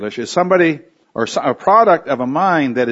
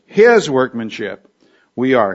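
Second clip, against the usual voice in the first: deliberate weight on HIS; emphatic HIS. Shown as a demonstration. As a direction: hit "His" hard as the first word, unhurried. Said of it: "for emphasis".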